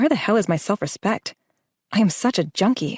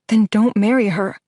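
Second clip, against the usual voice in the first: pleading, a touch louder; 3 semitones higher, 5 dB louder than usual. A narrator's reading. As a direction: beg him not to go through with it. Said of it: "I plead".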